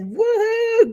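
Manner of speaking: high mock woohoo; a lightly voiced concert whoop; imitated fan yell. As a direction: echo a screaming fan in the crowd. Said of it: "yelling and screaming at the top".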